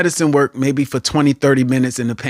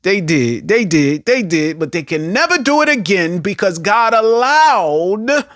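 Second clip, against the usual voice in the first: higher, rising preacher's cry; preaching climax; 6 semitones higher, 3 dB louder than usual. Real, not acted. Preacher declaring the attackers can never do it again, rising and insistent. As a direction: build to an emphatic, drawn-out declaration.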